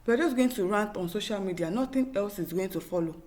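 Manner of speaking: ordinary delivery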